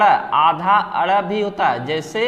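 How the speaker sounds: normal talking voice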